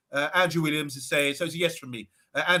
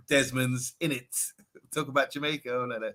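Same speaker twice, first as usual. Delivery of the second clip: ordinary talk, nothing marked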